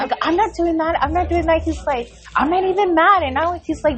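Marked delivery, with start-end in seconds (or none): 0.2-1.6 high-pitched imitation of a protesting son; mock defensive denial. Son protesting to mom mid-argument, mock defensive. 2.4-3.2 high-pitched imitation of an arguing son; mock defensive protest. Son insisting to mom in an argument, mock defensive.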